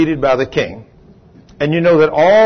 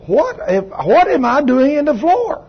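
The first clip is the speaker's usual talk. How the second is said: high, slow, puzzled question; woman standing up confused; dazed woman; 10 semitones higher, slower than usual. Retold or imitated. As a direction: drag it out, baffled. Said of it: "she said".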